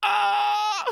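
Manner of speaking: high, loud hammed-up scream; mock screaming. Dazed classmate waking up screaming at the teacher, mock loud. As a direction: scream like you just woke up terrified.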